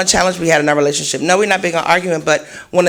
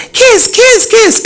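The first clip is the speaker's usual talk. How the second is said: put-on high, loud childlike chant; little kid cheering; 6 dB louder, much slower than usual. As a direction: cheer them on like an excited little one. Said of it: "she's always cheering us on".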